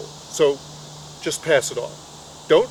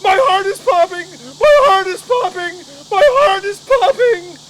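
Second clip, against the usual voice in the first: much higher and louder, repeated frantic yell; panicked scream; 14 semitones higher, 8 dB louder than usual. Retold or imitated. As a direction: scream it as if your heart is bursting. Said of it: "runs out of the tent screaming".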